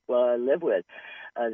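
ordinary delivery

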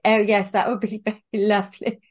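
put-on posh English, breaking into a laugh; mock your own best-behaved English accent, amused. Accepting the invitation to the funeral, exaggeratedly proper English accent. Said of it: "put on my best English accent".

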